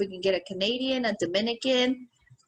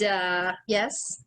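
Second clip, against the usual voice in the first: usual speaking voice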